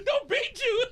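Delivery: high, laughing repeat; laughing echo. Real, not acted. Friend repeating the story's line, laughing. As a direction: chuckle it back.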